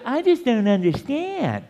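high-pitched imitation of a doubter; lightly put-on protest of not getting it; mock doubting believer. Preacher voicing a listener who won't tune in, mock helpless. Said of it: "So you say".

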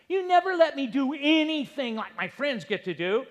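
put-on high, loud teenage protest; whining teenager. Preacher imitating a teen complaining to parents. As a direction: fire it back like an indignant teen. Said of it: "Teenagers complain".

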